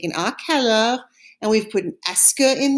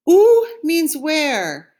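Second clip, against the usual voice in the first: Où means where, stretched slowly; slow, clear; 6 semitones higher, 6 dB louder, much slower than usual. Shown as a demonstration. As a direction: teach it slowly and loudly. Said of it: "Répétez".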